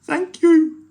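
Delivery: very high, loud thanks; relieved troll thanking the pirate chief; grateful troll. Acted. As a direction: gush the thanks.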